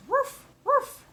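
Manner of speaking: put-on high-pitched, loud puppy woofs for the kids; dog barking. Shown as a demonstration. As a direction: bark like a playful little dog. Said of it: "an animal that says".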